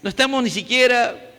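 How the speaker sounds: pastor's closing prayer. Real, not acted.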